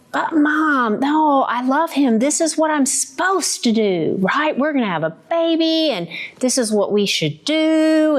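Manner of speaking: put-on high, insistent teen protest; pleading teenage protest. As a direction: argue like a lovestruck teen. Retold teenage protest to mom.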